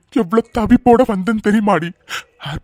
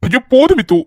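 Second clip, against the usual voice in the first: exasperated outburst; 6 semitones higher, 5 dB louder than usual. Acted. Exasperated husband cuts her off.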